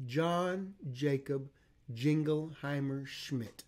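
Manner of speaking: John Jacob Jingleheimer Schmidt said very slowly; over enunciating. Shown as a demonstration. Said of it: "Ready? Go".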